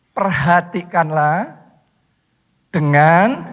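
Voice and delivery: low, slow, rising on "dengan"; prompting recitation. Real, not acted. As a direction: draw out "Perhatikanlah dengan", left hanging for the congregation. Prompts congregation to complete the verse.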